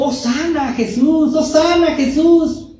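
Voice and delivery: high sing-song imitation of shallow praise; mock festive praise. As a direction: lift it high like empty celebration. Mimicking shallow Palm Sunday praise.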